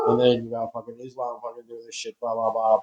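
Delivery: loud, exasperated rattling off of the news; yelling in frustration at the nightly news; angry rant. Real, not acted. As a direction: shout the list out in disgust. Ranting about the news.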